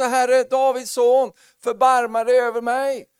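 high, loud, slow desperate cry for mercy. Retold or imitated. Bartimeus crying out to Jesus for mercy, loud and slow. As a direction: call out like a begging blind man. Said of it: "han skrek och ropade".

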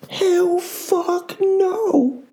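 high, very slow, stretched-out refusal; drawn-out hell no. Real, not acted. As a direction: drag every word out in scornful disbelief. Ranting refusal of forgiveness.

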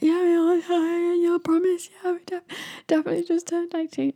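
very high, squeaky run of assurances; imitated eager pleading. Birthday girls convincing a bouncer, high eager pleading.

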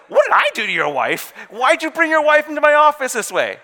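loud, high-pitched mock outrage; panicked retort. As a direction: yell the blame straight back, hammed up. Pastor throws accusation back, panicked.

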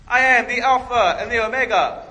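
loud, high, pompous proclamation; mock grand boast. A preacher mockingly declaring himself, a loud pompous boast. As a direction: boom it out like a show-off. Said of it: "if I got up in church and I was like".

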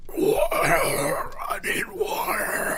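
high, slow croaking plea; parched whimper. Acted. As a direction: beg for water, parched.

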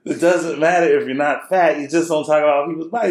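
high, loud, comically worked-up lecture; exasperated dad. Retold or imitated. Retold exasperated dad after laughing fit.

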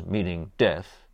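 usual speaking voice

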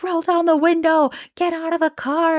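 retold police shout, high pitched; police commands. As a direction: bark it like a cop at gunpoint. Police with guns pointed.

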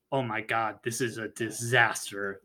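low, hushed horror; sinking, acted dread; quiet dismay. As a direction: murmur it like all is lost. Retold director dreading a disaster.

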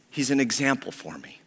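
normal talking voice

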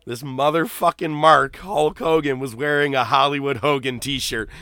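ordinary talk

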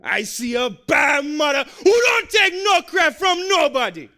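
slow, loud shouted declaration; shouting. Retold or imitated. Preacher re-acts Yul's shout.

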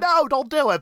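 high-pitched mock plea; exasperated pleading. Host pleading with a fictional character, exasperated.

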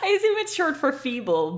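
real laughter through the words; amused. Real, not acted. Reader explaining a silly word to co-host, laughing.

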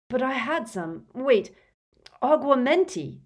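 frantic. A narrator's reading. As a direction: puzzle aloud, then cry the spell. Harry frantically retrying the spell.

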